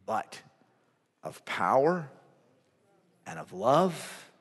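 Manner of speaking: low, hushed preaching with weighty pauses; solemn sermon close. Real, not acted. Preacher reading a final verse as musicians come, solemn hush. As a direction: bring it down low, phrase by phrase. Closing scripture and solemn appeal.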